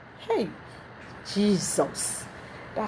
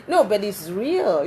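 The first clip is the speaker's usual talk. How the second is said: ordinary talk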